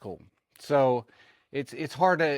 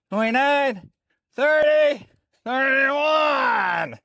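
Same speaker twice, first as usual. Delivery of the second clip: loud, higher counts building to strained; doing push ups; 7 semitones higher, 5 dB louder than usual. Real, not acted. Counting reps during push-up challenge.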